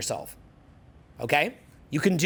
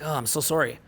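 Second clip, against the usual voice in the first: low, sympathetic mock apology; lightly acted pity; 4 semitones lower than usual. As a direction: feel sorry for them, pitched down. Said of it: "it looks like pity".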